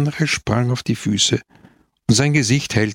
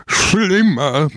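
a sudden loud bellow; 7 semitones higher, 4 dB louder than usual. Acted. Baciga roaring at Don Camillo about his daughters, bellowing. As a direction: roar the word out in outrage. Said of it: "brüllte der Genosse Baciga".

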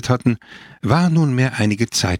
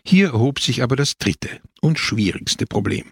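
ordinary delivery

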